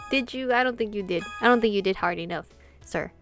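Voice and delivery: ordinary talk, nothing marked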